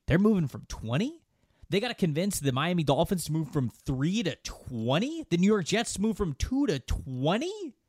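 disbelieving, with "twenty?" swooping up high; rising incredulity on each number; incredulous questioning. Real, not acted. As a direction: question it like it's absurd. Incredulous questioning of the trade jump.

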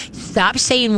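usual speaking voice

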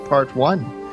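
ordinary talk, nothing marked

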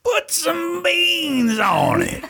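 long, high, drawn-out shout; catchphrase shout. Real, not acted. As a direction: belt it out slowly, way up high.

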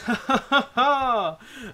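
high booming laugh; hearty host laugh. Acted. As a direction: laugh like a jolly showman. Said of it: "and he goes".

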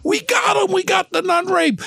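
high triumphant shout; yelling out the window. Retold or imitated. Cop yelling to the churchgoers from his car, triumphant high shout. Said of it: "I'm yelling out the window".

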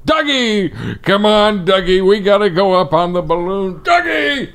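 put-on high old man calling a dog; cartoon grandpa yelling after his dog; Carl from Up voice. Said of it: "I'm the voice of the character".

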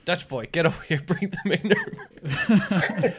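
put-on high, loud German-sounding Dutch accent; playful put-on Dutch-German voice; mock Dutch accent. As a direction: call the boy over in hammed-up Dutch accent. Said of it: "the Dutch accent is actually".

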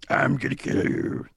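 slow, whispered killer threat; creepy strangler whisper. Retold or imitated. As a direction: breathe it like a strangler. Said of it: "wouldn't it be a Scranton Strangler quote".

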